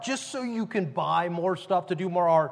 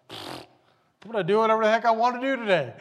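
higher-pitched, self-willed declaration; mock defiance; 4 semitones higher, 4 dB louder than usual. Someone waking up to do as they please, mock defiant. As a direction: act out a brash refusal to behave.

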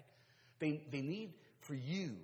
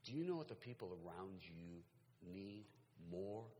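soft, low, with weighty pauses; hushed, deliberate sermon close; earnest hushed appeal; 4 semitones lower, 6 dB quieter than usual. Real, not acted. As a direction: plead quietly for their attention. Solemn appeal closing the sermon.

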